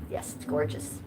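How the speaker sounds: soft breathless aside; whispered. Real, not acted. Streamer whispering praise of her mod's accent. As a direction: whisper it like a secret compliment.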